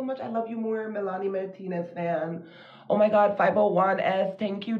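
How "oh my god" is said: ordinary talk, nothing marked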